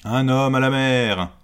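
loud high alarm call; bellowing over the wind. Acted. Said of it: "la voix du capitaine qui résonne".

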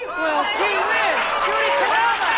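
high, loud, drawn-out gee whiz; delighted surprise at seeing Judy; surprised greeting. Acted. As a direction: call out her name in delighted surprise. Dennis greets surprise guest Judy.

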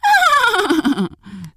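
high, quavering imitated neigh; whinny like a startled horse. Horse startles at the gingerbread man's taunt, high whinny.

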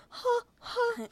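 high panicked huh huh; startled crying gasps; imitated scared woman's gasps, high; frightened gasps. Frightened woman watching the kidnapping.

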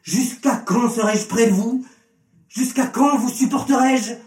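high, impatient rhetorical questions; exasperated. A narrator's reading. Jesus out of patience with the crowd, exasperated. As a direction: let the impatience climb through each question.

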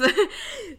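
high, loud burst of laughter; amused laugh. Real, not acted. Host amused by her birth-year aside, laughing. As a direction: laugh out loud at your own joke.

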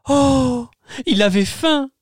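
put-on high amused kid voice; children exclaiming. Tutu and Booster crying out together, high child voice. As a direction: kids surprised by the eaten cake. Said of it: "s'écrièrent en chœur Tutu et Booster".

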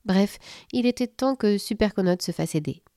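plain reading voice

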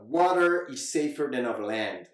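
put-on slow, overdone American accent; slow, heavy American accent; exaggerated American accent. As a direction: push the American accent until it sounds like a parody. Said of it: "je m'entends faire".

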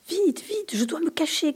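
high, frantic call; panicked cry. A narrator's reading. Chameleon bursting in, panicked high cry to hide. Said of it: "crie-t-il".

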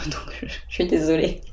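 whispered apology. Real, not acted. Dog snoring beside her.

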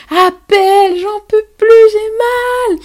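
high, loud desperate yell; yelling at partner. Retold or imitated. In labour, yelling at her partner to call, high desperate yell. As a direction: cry out for help, pitch way up. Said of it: "je lui hurle dessus".

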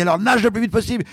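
high, urgent order to swim fast; coach's order. Retold or imitated. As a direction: relay her urgent command. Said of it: "Elle me dit".

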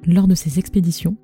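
ordinary talk

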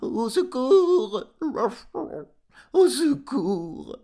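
high, loud, drawn-out dog's howl for help; crying dog. A narrator's reading. Farm dog calling to his sleeping master, crying out. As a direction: yelp it out like a panicking dog, stretched long. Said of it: "criait Garme".

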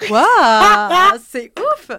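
loud high wow; amazed. Real, not acted. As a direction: gasp in delight. Reacts to turkey impression.

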